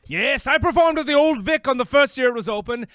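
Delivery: loud, fast theatrical boast; grand boast. Acted. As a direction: declaim it like an old star. Boasting actor ghost.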